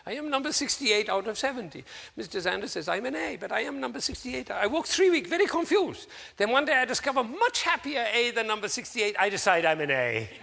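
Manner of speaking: high-pitched imitation of a Taiwanese student's accent. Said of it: "Forgive me for my attempt at his accent".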